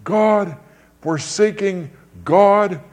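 high, slow, aching call to God; anguished cry. Retold or imitated. Luther crying out over the cross, high anguished cry. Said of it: "he finally said".

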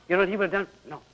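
normal talking voice

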